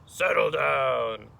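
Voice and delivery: loud, slow teacher's roar; drawn-out, booming command. Acted. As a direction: roar it slowly at an unruly class. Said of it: "Miz Kirby roared".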